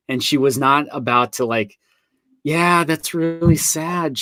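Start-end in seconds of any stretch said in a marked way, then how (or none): 2.4-4.2 mock sympathy.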